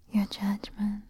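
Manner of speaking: usual speaking voice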